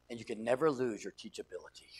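a hushed, intimate whisper; whispered point. Real, not acted. Preacher urging the congregation, hushed whisper. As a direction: lean in and whisper the truth.

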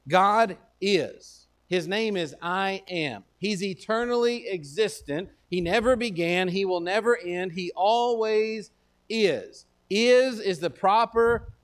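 full-force sermon; preaching. Acted. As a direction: proclaim God's eternity with force.

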